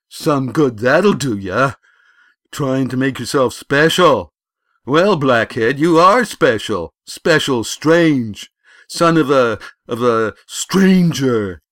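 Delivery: loud, high, drawn-out jeers; mock him at full volume; bully boy, scornful. A narrator's reading. Bully scorning his rival as a stranger, loud high jeering.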